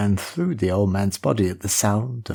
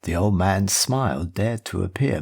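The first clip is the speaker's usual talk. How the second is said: ordinary delivery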